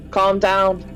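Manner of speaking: put-on short line in a stray Australian accent; accidental Australian accent. Guard restrains the fleeing Maynard, accidental Australian accent. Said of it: "Australian, I don't know why".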